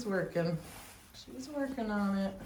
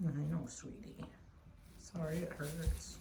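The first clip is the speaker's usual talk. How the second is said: soothing; 6 semitones lower, 9 dB quieter than usual. Real, not acted. Comforting animal in labour.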